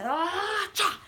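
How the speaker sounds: high rising "ah" into a sharp clash; vocal fight sound effect; imitated battle cry. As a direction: mimic leaping into combat. Mimics the leap into battle.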